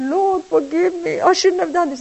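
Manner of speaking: pleading for forgiveness. Acted. Kneeling man begs the Lord to forgive his outburst, contrite. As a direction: beg it humbly, on your knees.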